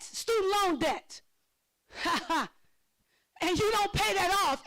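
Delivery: ordinary talk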